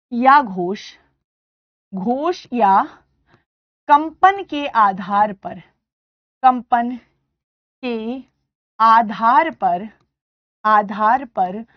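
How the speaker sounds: word by word dictation, each word spaced; teacher dictating notes word by word; slow dictation. Shown as a demonstration. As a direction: dictate slowly so students write it. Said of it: "dictating notes slowly".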